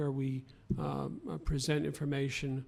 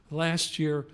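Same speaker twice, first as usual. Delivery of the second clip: louder, more deliberate restart; emphatic, measured restart; 8 dB louder than usual. Real, not acted. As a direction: start over firmly and louder. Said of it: "I know I'm talking too fast".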